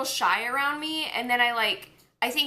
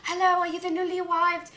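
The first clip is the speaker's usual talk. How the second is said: a higher, put-on foreign accent for a gentle knight; Theodore's accent; 6 semitones higher, faster than usual. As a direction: greet the newcomer in the knight's odd accent. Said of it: "he talks with an accent like this".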